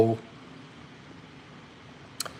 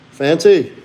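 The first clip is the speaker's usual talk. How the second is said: louder, higher call; calling the dog; 5 semitones higher, 8 dB louder than usual. Real, not acted. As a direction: call out the dog's name.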